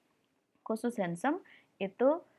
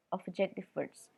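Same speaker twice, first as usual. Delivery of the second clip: normal talking voice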